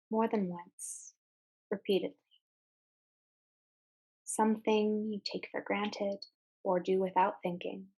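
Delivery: calm meditation guide with long, unhurried pauses; guided meditation. Real, not acted. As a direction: ease listeners into mindful breathing, phrase by phrase. Leads a guided mindfulness exercise.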